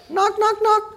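high, light knock-knock; high monotone knocks; imitated knocking. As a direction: imitate a polite tap at the door with the voice.

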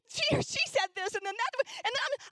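very high, rising whine; high whiny tattling voice; whiny teen girl. Retold or imitated. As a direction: babble the complaint like a teen. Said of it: "whiny voices".

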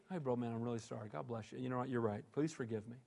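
hushed, humble sorry, low and gentle; soft answer. Retold or imitated. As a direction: soothe an angry man softly. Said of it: "with a soft answer".

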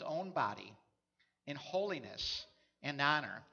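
measured, solemn Bible reading; scripture reading. Acted. Reads scripture.

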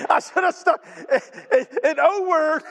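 laughing through "I should have stuck"; words breaking into laughter. Real, not acted. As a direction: giggle at own flub.

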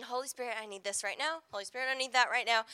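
soft, low, quick-murmured prayer; simple prayer. Shown as a demonstration. Preacher showing listeners a simple prayer, quiet and low. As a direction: pray it quietly, as if privately. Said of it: "praying a prayer and saying".

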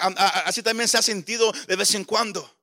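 ordinary talk

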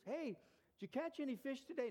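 high, bright mock greeting asking about fish; cheery friend. Acted friendly fishing question.

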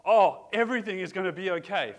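loud, high burst of relief; joyful relief. Retold or imitated. A worshipper bursting out loud with joyful relief.

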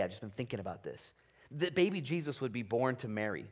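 normal talking voice

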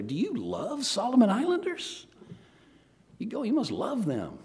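swooping, syrupy acted concern; gushing questioner. Acting out gushing questioner to wife.

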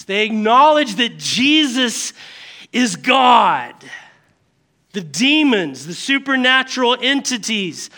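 ordinary talk

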